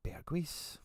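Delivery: hushed, secretive query; whispered question. Real, not acted. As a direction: whisper the name Berghuis as a question. Hushed check of lineup.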